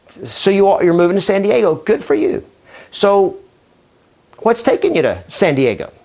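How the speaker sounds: conversational script, with a held beat after "So"; react, say "So", wait, then ask the question; thoughtful pause. Shown as a demonstration. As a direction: pause after "So" before asking "what's taking you to San Diego". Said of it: "I just threw in a little pause".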